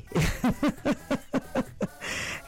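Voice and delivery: airy giggle, high and soft; breathy high laugh. Real, not acted. Host laughing at her own singing on air.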